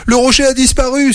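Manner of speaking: high-pitched cry of surprise; astonished cry. Acted. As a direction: cry out at the missing rock. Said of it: "s'écrie le loup". (crier de surprise, voix aiguë)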